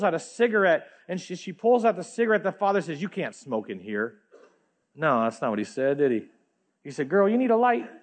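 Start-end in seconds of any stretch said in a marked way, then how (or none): 3.0-4.2 softer, lower stern refusal, a hint of a disapproving church; clipped rule-giving from a lightly voiced strict church; cold church rebuke. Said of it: "That's what the church would say". 7.1-7.9 higher, louder, eager offer; welcoming father. Retold or imitated. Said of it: "It's not what the father would say".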